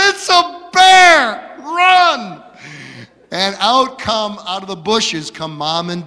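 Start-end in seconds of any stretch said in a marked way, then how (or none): 0.1-2.4 put-on a kid's terrified shout, much higher and louder, slowed; panicked yell. Boy lost in the woods hearing growls, panicked shouting. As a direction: scream the warning to run. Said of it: "And I said".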